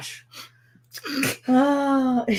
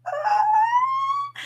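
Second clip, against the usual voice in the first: adoring coo. Retold or imitated. Mom recalls her precious newborn, high adoring coo. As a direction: melt over a cute newborn. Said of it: "and just like".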